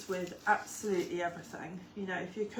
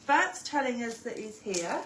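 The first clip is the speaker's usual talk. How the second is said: ordinary delivery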